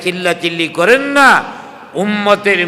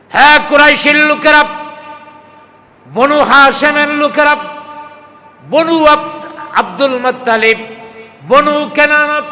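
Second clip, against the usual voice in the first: high, raised voice summoning clans one by one; calling out; 8 semitones higher than usual. Retold or imitated. Prophet summons the clan leaders from Safa hill, calling out. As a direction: imitate a leader's ringing roll call, pitched high. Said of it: "নাম ধরে ধরে ডাকলেন".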